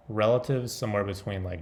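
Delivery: ordinary talk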